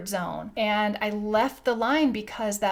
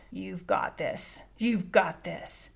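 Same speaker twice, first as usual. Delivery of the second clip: slow, slightly lowered imitation of a deep voice; Tony Robbins; 3 semitones lower, 5 dB quieter, slower than usual. Giant man reassuring her before the fire walk, imitated deep voice. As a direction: attempt a big man's deep voice, unhurried. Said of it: "can't even do the voice as deep".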